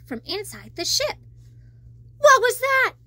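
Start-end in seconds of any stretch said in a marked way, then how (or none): 2.2-3.0 high, loud nervous question. Acted. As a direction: ask it jumpy and scared. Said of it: "Flounder asked nervously".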